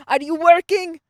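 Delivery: very loud, high demanding question; imitated professor. Professor to student at back of lab, loud imitated demand. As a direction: mimic a professor calling out a student. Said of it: "he's like".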